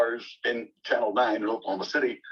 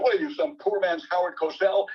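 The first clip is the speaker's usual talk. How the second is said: higher-pitched retold outburst of pretend anger; indignant mock tirade, pitched up; mock ranting; 8 semitones higher than usual. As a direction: imitate a put-on angry rant. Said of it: "he made believe he was mad".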